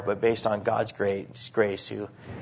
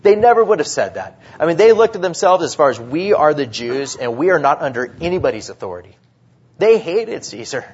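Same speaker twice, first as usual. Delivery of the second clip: loud, higher, driving preacher's climax; preaching at full force; 3 semitones higher, 7 dB louder than usual. Real, not acted. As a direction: hammer the point home to the church.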